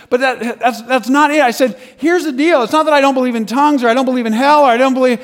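high, loud, urgent arguing; heated rebuttal. Retold or imitated. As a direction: argue back over him in front of a crowd. Retold heated argument with preacher.

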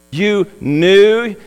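"knew" leaned on in scornful disbelief; a mocking "You knew?" with "knew" pressed hard; sarcastic. The master throws the servant's words back at him sarcastically. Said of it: "you can almost hear the sarcasm in his tone".

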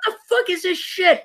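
high, confused disbelief; baffled high voice. Retold or imitated. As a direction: ask as if totally lost. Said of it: "'cause I'm like".

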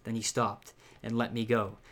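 ordinary talk, nothing marked

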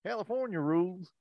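put-on half-hearted Southern accent. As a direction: practice a Southern accent under your breath. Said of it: "I can't think Southern".

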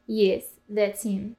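slow, put-on Russian accent; waitress's slow Russian-accented English. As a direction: slip back into the fake Russian accent. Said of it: "Again with the accent?".

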